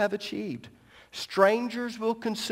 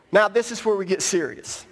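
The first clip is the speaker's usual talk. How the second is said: usual speaking voice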